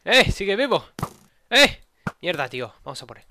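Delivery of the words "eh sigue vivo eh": high startled yell; surprised shout. Real, not acted. Enemy survives.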